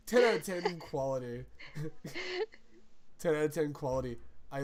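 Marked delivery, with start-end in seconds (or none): 1.5-2.5 short squeal of laughter, much higher; high squeaky laugh. Real, not acted. Podcaster cracking up at own joke, high squeaky laugh. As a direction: burst into a high squeaky giggle.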